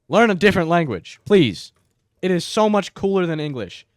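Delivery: loud, pressing appeal to the audience; loud plea. Real, not acted. Urging English learners worldwide to pick another language, loudly. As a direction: urge them hard to switch languages.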